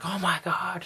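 high-pitched imitated panic; hammed-up horror, pitched high; mock freak-out. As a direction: imitate an alarmed dog owner. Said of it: "they would freak out".

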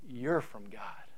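soft breathy whisper. Acted. Preacher whispering assurance to his flock, hushed whisper. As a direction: lean in and whisper it like a secret.

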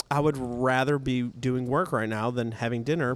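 ordinary talk

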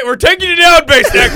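high, loud call-out; mock threat. Hosts announce their exposé on a DJ, mock threatening. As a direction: holler a hammed-up takedown.

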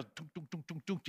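soft quick tunk tunk; hushed clacking rails; train wheel clacks. Retold or imitated. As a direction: tap out the rails under your breath. Imitating train wheels rushing by.

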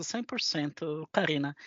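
ordinary delivery

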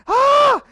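high, loud burst of a cry; excited shriek. Real, not acted. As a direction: let out a sudden yelp of glee. Laughing outburst at own joke.

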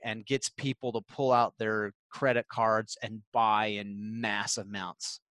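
drawn out slowly, each phrase weighted; a slow, building sales pitch whose grandeur is partly tongue in cheek; slow dramatic question. Retold or imitated. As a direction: spell out the dream question slowly, like a grand vision. Quoting mentor's big question, dramatically.

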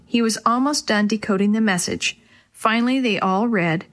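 ordinary delivery

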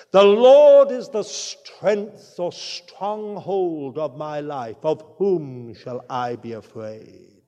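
declaiming scripture, big on the Lord lines, dropping low on the questions; reciting a psalm. Acted. Preacher quoting the psalmist to his flock, solemn recital. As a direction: proclaim the psalm boldly, then hush on the fear lines. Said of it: "says the psalmist".

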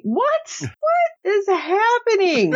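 very high, drawn-out disbelief; hammed-up squeal of confusion; mock bewilderment. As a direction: stretch it out in high-pitched shock. Voicing bewildered reaction to proposal.

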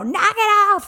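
high, loud mom reprimand; imitated scolding yell. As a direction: act out breaking up fighting kids with a shout. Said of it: "so I'm, like, yelling".

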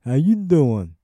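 loud, hammed-up Brooklyn greeting; bad Brooklyn accent. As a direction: toss it off like a Brooklyn wise guy. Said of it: "in that bad Brooklyn accent".

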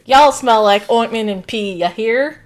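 loud put-on Southern voice; hammed-up Southern American taunt; mock Southern accent. Co-host riffing on a cartoon insult, mock Southern accent. Accent game, y'all version.

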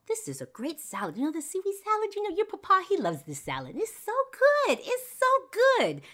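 very high, loud comic dad voice; hammed-up rave about food, pitch leaping; gushing dad. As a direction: squeal with delight as a silly dad. Dad suddenly raves about his salad.

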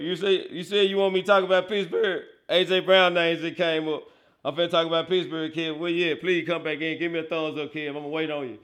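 usual speaking voice

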